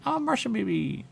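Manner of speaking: squeaky hammed-up Martian; high silly voice. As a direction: sing it up high like a cartoon. Repeating the Martian line twice.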